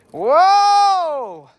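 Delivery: high, loud falling whoa; real astonished whoa; surprised shout. Real, not acted. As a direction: gasp of amazement out loud. Second look at the device.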